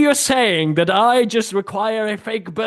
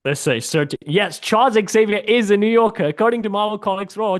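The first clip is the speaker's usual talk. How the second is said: usual speaking voice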